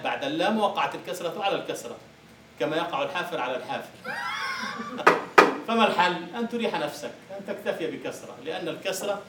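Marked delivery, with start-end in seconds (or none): 3.9-5.6 sudden loud burst of high laughter. Real, not acted. Teacher to students, a loud laughing burst. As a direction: let a high laugh burst out over the joke.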